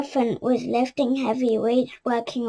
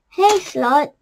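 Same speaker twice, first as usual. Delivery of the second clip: loud call; 3 semitones higher, 6 dB louder than usual. A narrator's reading. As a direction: call out to him. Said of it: "they called".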